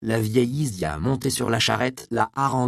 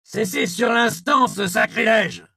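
high, loud officer's order; commanding shout; 14 semitones higher, 8 dB louder than usual. A narrator's reading. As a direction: stop them dead with authority. Lieutenant orders them to stop. (le lieutenant ordonne d'arrêter, crié fort et aigu)

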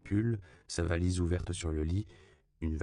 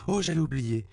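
high, louder sudden recollection; exclaiming; 10 semitones higher, 5 dB louder than usual. A narrator's reading. Woman suddenly remembers something to give back, exclaiming. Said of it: "qui s'exclama".

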